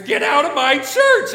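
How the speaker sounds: full-voiced imitation of an outraged friar; loud, high yell to throw someone out; screaming. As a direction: bellow it like a fed-up churchman. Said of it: "out of the chapel and screams".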